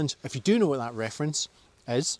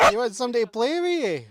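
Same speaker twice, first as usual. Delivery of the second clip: much higher sing-song pet talk; 10 semitones higher, 5 dB louder than usual. Real, not acted. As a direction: coo even higher at the dog. Repeating to the dog.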